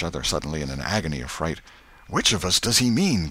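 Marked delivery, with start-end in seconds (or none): 2.1-3.3 understated fearful question, pitch raised; frightened gasp. A narrator's reading. Huck hears the dog howl, mildly gasped fear. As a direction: ask with a touch of gasping fright. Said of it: "gasped Huckleberry".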